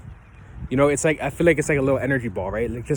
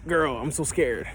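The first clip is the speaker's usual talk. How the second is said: softer, slow, hushed mock panic; mock nervous fright; 7 dB quieter, slower than usual. Guy approaching a girl, hushed mock nervousness. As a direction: play it like a guy scared to talk to a girl. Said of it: "we get nervous as hell".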